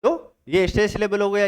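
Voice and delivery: normal talking voice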